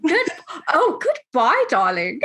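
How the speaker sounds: a hint of a British accent. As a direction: toss off goodbye darling as a Brit. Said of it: "I can be British".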